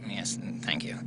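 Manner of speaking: breathy whisper from a low-voiced puppet; dummy whispering a weary yes. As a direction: hush it out, a mousy dummy.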